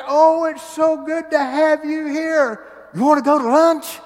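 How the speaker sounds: eager church lady. Retold or imitated. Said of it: "walks up to me right after that and says".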